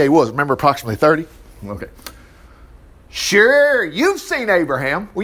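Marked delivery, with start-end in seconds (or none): 3.1-5.1 drawn-out mocking "sure"; sarcastic disbelief. Leaders doubt Jesus has seen Abraham, mocking. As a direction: start high, then drag it out sarcastically.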